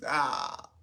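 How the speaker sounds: ordinary talk, nothing marked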